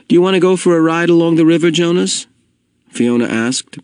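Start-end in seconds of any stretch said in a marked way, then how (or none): 0.1-2.3 nervous. A narrator's reading. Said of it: "biting her lip with nervousness".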